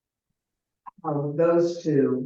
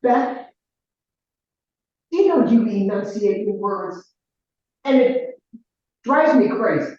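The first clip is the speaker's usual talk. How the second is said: raised, exasperated complaint; irritated sister; 4 semitones higher, 7 dB louder than usual. Retold or imitated. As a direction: snap at her, higher and louder. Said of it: "She goes".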